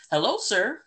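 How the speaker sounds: high, loud, put-on voice for the boss; hammed-up eager greeting to a superior; code-switched work voice. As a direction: switch into an exaggerated polite workplace voice. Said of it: "so that's code switching".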